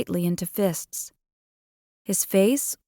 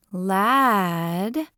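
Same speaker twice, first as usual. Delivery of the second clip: bratty drawn-out name. A narrator's reading. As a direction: drag Lad into two teasing syllables. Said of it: "dragged the word out into two syllables".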